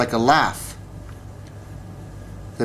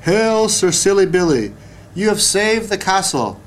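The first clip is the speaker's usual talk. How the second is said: crowd cheering; 8 semitones higher than usual. Acted. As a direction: hail the hero with a triumphant shout. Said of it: "Everyone in the castle was shouting".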